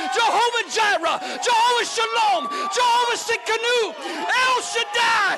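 high, slow, chanting shouts of praise; rhythmic roll call of holy names, climbing to a sustained high shout; full-force preaching. Real, not acted. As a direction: build each title into an exultant, drawn-out cry.

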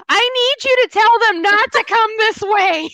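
loud, high-pitched mock panic; retold urgent plea. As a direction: call it out high and insistent, acting desperate. Retold plea amid hounding fans.

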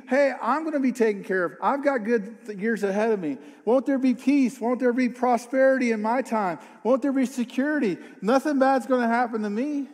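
mock the king's self-assured confidence; smug king's thoughts. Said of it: "he's thinking".

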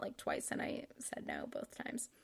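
an embarrassed whispered admission; sheepish whisper. Real, not acted. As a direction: let it slip out in a guilty whisper. Confessing, drops to whisper.

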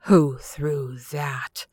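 slow, strangled furious demand; choking with rage. A narrator's reading. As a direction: force it out through a tight throat. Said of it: "he demanded in a choking voice".

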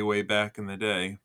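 normal talking voice

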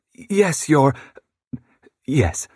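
high, halting nervous lady; flustered stammer. A narrator's reading. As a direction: stumble over the words, lightly voiced. Hostess stammers to president.

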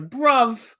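loud, high mock British accent; Will Ospreay impression. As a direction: punch it out in a bad British accent. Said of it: "I can't do a British accent".

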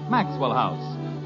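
ordinary delivery

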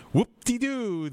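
high, loud sarcastic whoop-de-doo; sarcastic little cheer; mock cheer. Real, not acted. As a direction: toss it off with mock glee. Mocking quip on quote.

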